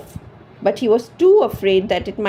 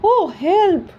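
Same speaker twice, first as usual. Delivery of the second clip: moaned; 10 semitones higher, 4 dB louder than usual. A narrator's reading. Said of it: "moaned this wife".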